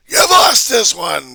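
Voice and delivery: high, loud, slow taunt; gloating shout. Acted. Vlogger voicing mob taunting the author. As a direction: taunt him with the win.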